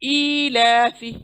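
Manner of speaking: slow, drawn-out ईलाफी with the long vowel held; slow tajweed reading of ईलाफी; Quran recitation drill. Shown as a demonstration. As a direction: stretch ईलाफी slowly, cutting off फी. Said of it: "आवाज़ को छोड़ देना है".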